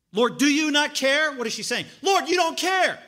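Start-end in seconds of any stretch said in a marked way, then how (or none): 0.1-1.3 loud, high, slow sarcastic question; snarky accusation. Retold or imitated. Martha impugning Jesus, loud and snarky. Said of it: "it's a snarky question". 2.0-3.0 loud, high accusing cry; top of her lungs. Retold or imitated. Martha yelling at the Lord at the top of her lungs. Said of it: "at the top of her lungs".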